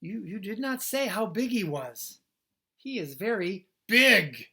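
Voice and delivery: high, loud stammering complaint; loud, high flustered protest; shaken protest. Acted. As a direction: blurt it out, rattled. Gerald shaken after seeing whale.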